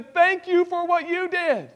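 high, impassioned grateful confession; earnest preached prayer of gratitude; fervent thanksgiving. Pretend. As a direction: pour out thanks, crying out the guilt. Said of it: "will stand before the Lord and say".

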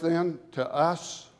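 ordinary talk

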